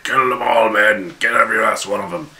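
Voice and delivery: rally the troops to slaughter; rallying cry. Retold or imitated. Commander cheering his men on to kill, loud rallying cry. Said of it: "he's kinda cheered them on".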